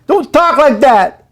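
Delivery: loud, high, sobbing pleas; blubbering and begging through tears; ugly crying. Acted. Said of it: "enough of the ugly crying".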